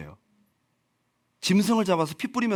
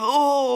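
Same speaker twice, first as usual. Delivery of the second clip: high, loud mock gasp; startled cry; 8 semitones higher, 5 dB louder than usual. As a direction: yelp as if suddenly touched by a coal. Replaying the startled reaction. (놀란 척 높고 크게 "어")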